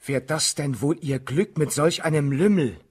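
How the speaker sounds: loud scornful question, stress on Lümmel. Acted. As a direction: sneer at the rival.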